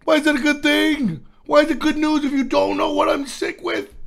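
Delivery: high-pitched disbelief; squeaky outraged questions; incredulous. Retold or imitated. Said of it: "I was unhappy".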